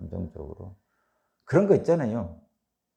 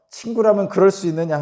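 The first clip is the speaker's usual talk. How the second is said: higher, louder reproachful question; acted indignation; 6 semitones higher, 6 dB louder than usual. Preacher voicing Job confronting his friends, indignant reproach. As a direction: challenge them, pitch and volume raised. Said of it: "막 화를 내는 거죠".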